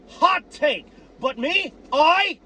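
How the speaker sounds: ordinary talk, nothing marked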